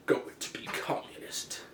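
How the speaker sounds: slow, whispered Stalin decree; whispered mock order. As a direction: drop to a slow, menacing whisper. Stalin quietly imposing his will.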